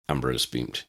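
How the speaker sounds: ordinary delivery, nothing marked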